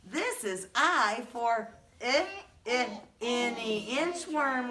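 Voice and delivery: drawn-out short I sound in "I, I, any inchworm"; slow letter sound demo. Shown as a demonstration. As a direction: stretch the "I" in "inchworm" for little kids. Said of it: "Show me".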